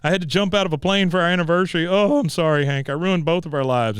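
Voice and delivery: loud, high, imitating a sobbing wife; host imitating Peggy's tearful lament, mock sobbing; mock crying wail. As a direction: ham up Peggy's weepy wail. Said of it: "And she's just crying".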